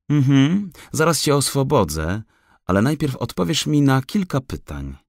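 high, loud forester voice; decisive forester. A narrator's reading. Forester bargains with the trapped raccoon, decisive. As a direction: set terms before freeing him. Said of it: "zdecydował".

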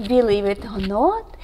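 plain reading voice

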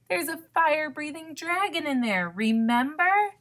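high, fast alarmed reminder; worried storybook protest, not shouted; urgent warning. A narrator's reading. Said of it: "Raccoon shouted".

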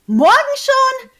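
high, loud outraged squeak; can't believe it, voice jumps up; shocked disbelief. Acted. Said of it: "fragte ich".

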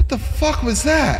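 high-pitched startled disbelief; shocked. Real, not acted. Player reacts to a sudden scare, shocked. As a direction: blurt it high, still rattled.

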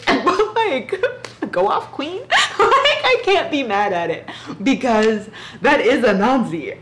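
high giggly fangirling; laughing while saying she can't be mad. Real, not acted. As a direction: giggle it out, delighted. Laughs while defending the book.

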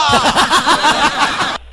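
short burst of real laughter; amused laugh breaking through annoyance. Real, not acted. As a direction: laugh it off, disbelieving. Caller laughs after being pranked.

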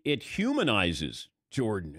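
ordinary talk, nothing marked

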